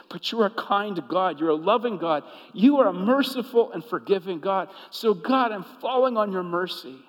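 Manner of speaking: loud, pleading prayer to a merciful God; fervent prayer. Acted. Pastor modelling a repentant prayer, loud and fervent. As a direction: cry out to God for mercy.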